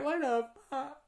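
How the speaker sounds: high-pitched comic shock; mock shocked disbelief. Imitating Gerda being ripped apart, mock shock.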